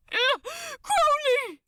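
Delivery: high, loud scream of his name; terrified cry. Acted. Aziraphale screams for Crowley as his hand slips, terrified. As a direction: cry out in terror and pain. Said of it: "cried out in terror and pain".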